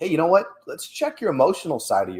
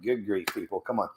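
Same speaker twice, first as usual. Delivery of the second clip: muttered good grief; 3 semitones lower, 8 dB quieter than usual. Retold or imitated. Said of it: "It's like".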